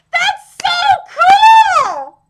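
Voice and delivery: high, loud, slow squeal with a laugh; laugh-squeal in pure delight. Real, not acted. Delighted reaction to ability.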